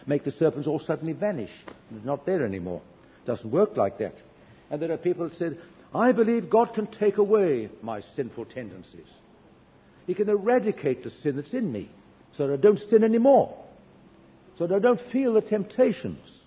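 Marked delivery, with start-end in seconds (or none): 5.9-16.4 high-pitched declaration of belief; acted confident believer, pitched up; quoted earnest believer. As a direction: declare it insistently in a raised pitch, as another's claim. Voices believers he disagrees with.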